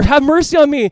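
loud, high-pitched desperate plea. Acted. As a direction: cry it out over those silencing you. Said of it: "he's crying out more".

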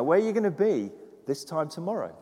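ordinary talk

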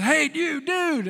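high, slow call across the lot. Retold or imitated. Stranger calling after the pastor at his car, drawn-out high hail. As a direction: hail him from the doorway, drawn out.

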